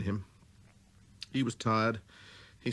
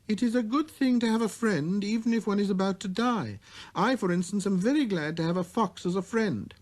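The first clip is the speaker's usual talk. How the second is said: put-on high, earnest boy's voice; little prince; 11 semitones higher than usual. A narrator's reading. Little prince explains children to the switchman, high and earnest. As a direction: speak up like a wise small boy.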